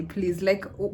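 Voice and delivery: ordinary delivery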